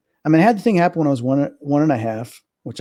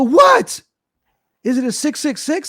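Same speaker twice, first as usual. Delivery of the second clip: mock-alarmed kid, pitched way up; terrified; 13 semitones higher, slower than usual. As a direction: ask it in re-enacted panic. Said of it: "I'm, like, terrified".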